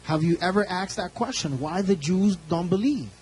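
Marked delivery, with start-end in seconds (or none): none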